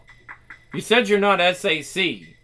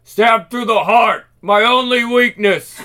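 booming frost giant; 6 semitones higher, 6 dB louder than usual. Acted. Giant dying from stab.

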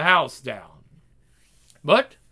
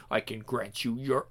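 whisper; 6 semitones lower, 11 dB quieter than usual. Acted. Demon tempting softly.

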